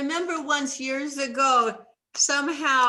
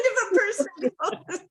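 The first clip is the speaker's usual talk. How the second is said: laughing; 10 semitones higher than usual. Real, not acted. Host laughs at her advice.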